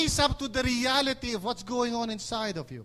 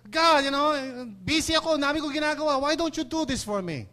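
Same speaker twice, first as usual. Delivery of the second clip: higher, louder impatient order to God; mock busy demand; 5 semitones higher, 4 dB louder than usual. Believer ordering God around, impatient and higher. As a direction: brush God off like a busy boss.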